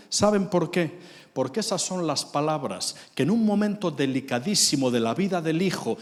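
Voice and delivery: ordinary talk, nothing marked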